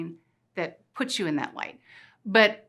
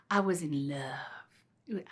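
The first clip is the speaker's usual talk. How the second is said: slow, lightly Southern drawl; a hint of Southern belle, slowed right down; dreamy Southern accent, lightly done; 5 semitones lower, 6 dB quieter, much slower than usual. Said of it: "I'm talking in this Southern voice".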